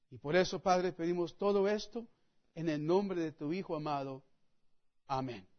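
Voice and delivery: low, soft, reverent prayer voice. Acted. Pastor praying over the reading for the congregation, low and hushed.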